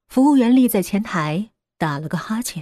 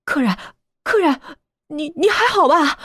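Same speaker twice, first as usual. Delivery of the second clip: urgent, higher-pitched calling out; anxious calling; 6 semitones higher than usual. Acted. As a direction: call through the door anxiously. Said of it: "着急地叫道".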